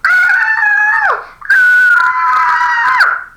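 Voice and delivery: high, loud horrified screams; repeated shrieks. Acted. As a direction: shriek again and again in despair. Keeps screaming over hair.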